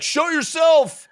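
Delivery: high, loud mock-heroic demand; hammed-up challenge. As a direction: call out a theatrical challenge. Acted challenge to the dying god.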